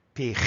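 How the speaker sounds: pig ending in a throaty Spanish j sound; wrong final G. Shown as a demonstration. Teacher showing how not to say pig, final j sound. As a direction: say pig as "pij", rasped final consonant. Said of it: "No decimos pig".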